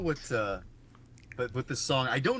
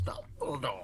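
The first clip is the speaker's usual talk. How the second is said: puppet voice; 7 dB quieter, slower than usual. Retold or imitated. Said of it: "another puppet goes".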